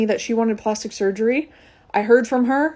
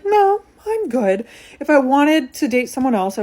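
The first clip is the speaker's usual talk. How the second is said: firm refusal; 5 semitones higher, 3 dB louder than usual. Retold or imitated. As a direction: draw it out and shut it down. Refusing friend's dating advice.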